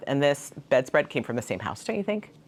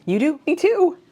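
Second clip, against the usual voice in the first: much higher and louder, delighted; playful squeal; 7 dB louder than usual. Pretend. Answering imagined viewers who love owls, a high playful squeal. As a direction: chirp it like a giddy friend.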